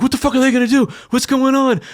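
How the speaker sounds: high, anxious mock questioning; retold alarm. Staring at rival gang, alarmed.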